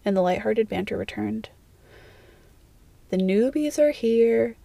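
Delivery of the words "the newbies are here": teasing sing-song, drawn out slowly. A narrator's reading. A friend announces the newbies from behind Draco, sing-song. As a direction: stretch it into a slow sing-song lilt. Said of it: "came a sing-song voice".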